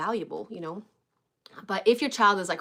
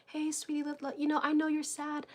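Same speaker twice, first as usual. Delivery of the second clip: put-on soft high coo to a crying child; gently comfort, voice lifted and hushed; soothing sweet mom; 8 semitones higher, 5 dB quieter than usual. Mom kneeling to soothe her sad child, soft high coo.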